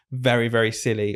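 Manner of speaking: normal talking voice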